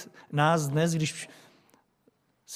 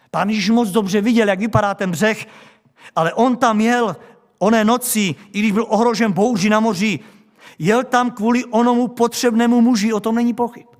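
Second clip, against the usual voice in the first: loud, driving sermon voice; full-voiced pulpit proclamation; forceful preaching; 4 semitones higher, 7 dB louder than usual. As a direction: preach it out to the whole church.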